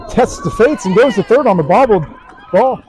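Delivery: high, loud call of a daring run; excited play-by-play. Real, not acted. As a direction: call the steal as it happens. Live call of a daring steal.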